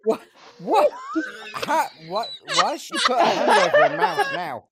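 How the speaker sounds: high, loud, helpless laughter in the words; laughing question. Real, not acted. Host teasing co-host over her reaction, laughing hard. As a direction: ask it while cracking up.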